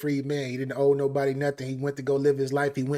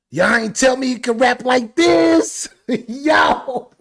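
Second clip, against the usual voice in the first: higher, louder slow shout; hype shout; 8 semitones higher, 8 dB louder, slower than usual. Real, not acted. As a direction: yell it to the chat. Shouting in hype over music.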